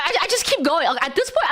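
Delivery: ordinary delivery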